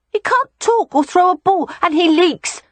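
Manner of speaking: put-on high, loud child voice; small boy listing grievances; complaining little boy. A narrator's reading. Boy lists his brother's faults.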